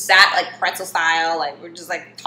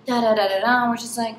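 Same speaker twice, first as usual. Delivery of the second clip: normal talking voice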